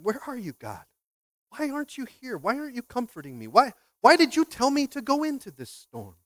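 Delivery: mildly acted protest, pitch lifting; pleading complaint. Believer in a storm asking God why, mildly aggrieved. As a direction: question God like someone feeling abandoned. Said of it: "I would have been the same way".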